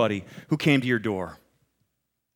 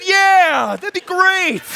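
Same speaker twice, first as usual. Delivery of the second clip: much higher, louder, cheerful yes; eager yes; 13 semitones higher, 5 dB louder, much slower than usual. Retold or imitated. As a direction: jump at the offer with a laugh. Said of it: "Dan broke every rule".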